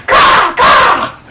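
high, loud, airless imitated caw; raven caw. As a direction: squawk out like a raven.

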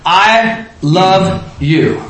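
very slow, with weight on "I"; stress on I. Shown as a demonstration. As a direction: draw out "I love you", leaning on "I". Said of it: "where's the emphasis?".

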